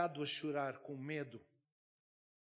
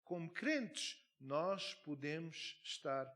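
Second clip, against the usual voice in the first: louder, higher, slow emphatic preaching; forceful preaching; 5 semitones higher, 5 dB louder, slower than usual. Acted. Pastor preaches to the congregation about the oppressed with forceful emphasis. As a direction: hammer each phrase home to the congregation.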